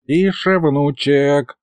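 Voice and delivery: put-on high, urgent hushing from an old man; mock hissing. A narrator's reading. Grandpa hushing grandson near the castle, lightly hissed. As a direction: shush him without really whispering. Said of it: "зашипел на меня дед".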